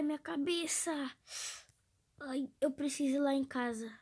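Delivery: usual speaking voice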